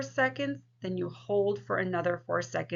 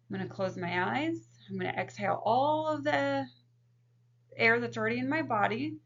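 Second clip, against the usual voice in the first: guided breathing demo; 3 semitones higher than usual. Shown as a demonstration. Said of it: "I'm gonna go ahead and demonstrate it for you".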